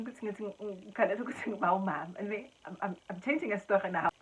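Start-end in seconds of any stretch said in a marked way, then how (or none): none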